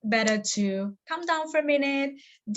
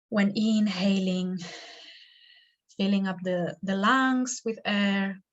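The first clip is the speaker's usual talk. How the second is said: guided meditation. Acted. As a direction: lead them gently into stillness, stretching the words.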